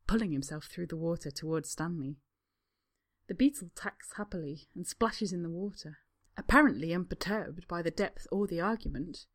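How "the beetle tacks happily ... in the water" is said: ordinary delivery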